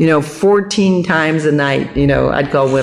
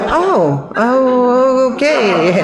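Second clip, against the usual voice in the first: high, drawn-out stalling oh; dismayed surprise; 8 semitones higher, much slower than usual. Retold or imitated. Caller learning John is right there, taken aback. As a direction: realize you're caught and stretch it out.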